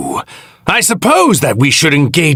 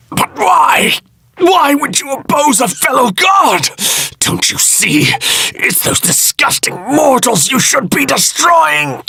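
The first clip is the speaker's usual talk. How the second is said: shrill divine fury, much higher; outraged shout. Acted. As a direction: rage at a fellow god who betrays you. God enraged at opposition.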